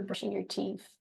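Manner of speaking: ordinary talk, nothing marked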